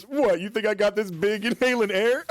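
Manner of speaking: high-pitched mock scoff. Woman in an interview asked if she likes to eat, scoffing. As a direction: imitate an offended, incredulous comeback. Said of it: "she scoffs".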